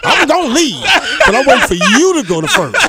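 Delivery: high, loud comic pleading through laughter; worked-up mock refusal to budge; comic insistence. Comic refusal to leave before police.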